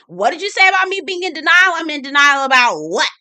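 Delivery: high, loud mock indignation; quick, loud imitated comeback; retort, flipping around. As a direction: fire it back instantly, imitating outrage. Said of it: "Kyle flips around immediately".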